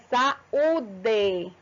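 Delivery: "saúde" slowly split as sa-ú-de; separated vowels. Shown as a demonstration. Teacher showing students a hiatus, vowels slowly separated. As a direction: hold the A and Ú of "saúde" apart. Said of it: "essas vogais se separam".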